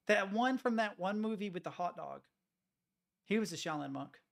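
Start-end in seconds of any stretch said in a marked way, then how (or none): none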